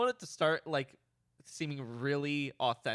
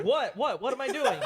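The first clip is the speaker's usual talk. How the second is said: acted bafflement; 6 semitones higher, 4 dB louder than usual. Clueless man questioned about fire.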